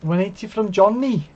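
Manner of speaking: commentator call. Real, not acted. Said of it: "I don't know why I said it like that".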